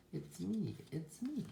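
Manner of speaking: high-pitched little sheep voice; sheep character. A narrator's reading. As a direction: reveal yourself as a storybook sheep. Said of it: "says the sheep".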